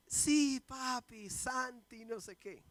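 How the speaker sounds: put-on high excited little girl; hint of a delighted little daughter; child voice. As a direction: squeal it like a happy five-year-old.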